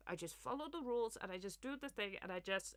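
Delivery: blocked-nose politician voice; Starmer. Acted. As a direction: talk through the nose like a dull rule-follower. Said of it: "It's in the nose".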